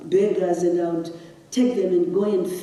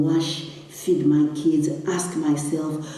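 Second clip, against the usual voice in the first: usual speaking voice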